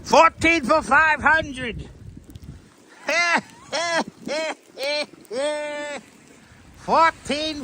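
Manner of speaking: high, slow put-on hawker voice; drawn-out street vendor pitch; hammed-up high slow sales call; mock haggler.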